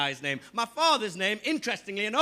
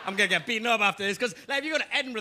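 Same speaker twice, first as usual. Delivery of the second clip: normal talking voice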